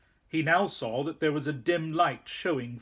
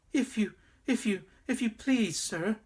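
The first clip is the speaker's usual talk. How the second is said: put-on high, stammering terrified boy; trembling with fear; 8 semitones higher than usual. A narrator's reading. Frightened boy answering the wolf at the door, trembling stammer. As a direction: stammer it out, barely able to speak. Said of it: "trembling so that he could hardly speak".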